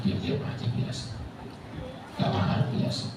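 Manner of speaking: very soft, understated delivery; hushed composure. Shown as a demonstration. Preacher showing how the pious take insults, hushed composure. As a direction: drop right down to a near-murmur, unbothered. Models staying quiet when insulted.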